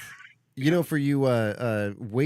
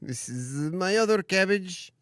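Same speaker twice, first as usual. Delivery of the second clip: proud cabbage owner; 7 semitones higher, slower than usual. Acted. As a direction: show off your prized cabbage slowly. Acting proud cabbage town resident.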